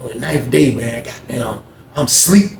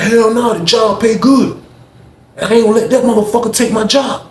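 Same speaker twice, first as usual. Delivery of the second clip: cocky refusal; 9 semitones higher, 3 dB louder than usual. Retold or imitated. Neil defending his job.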